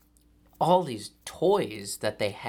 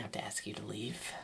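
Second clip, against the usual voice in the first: hushed funeral whisper; 11 dB quieter than usual. Acted. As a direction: whisper it apologetically.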